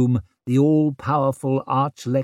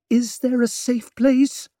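frightened; 11 semitones higher than usual. A narrator's reading. Savine answers scared.

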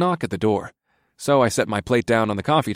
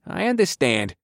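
meek inspector voice; 8 semitones higher than usual. A narrator's reading.

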